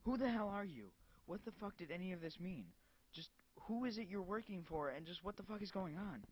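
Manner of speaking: high, heated demand; angry outburst. Acted. Cassandra demanding answers from the caller, furious. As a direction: hurl the questions at him.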